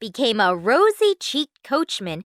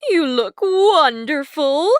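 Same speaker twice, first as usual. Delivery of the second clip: gasping mother; 6 semitones higher, 7 dB louder, slower than usual. A narrator's reading. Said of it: "their mother gasped".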